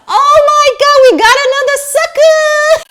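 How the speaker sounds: mock the scammers crowing over a fresh victim; mocking gleeful scammers. Imitating the sellers packing her dress, hammed-up glee. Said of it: "laughing their asses off, like".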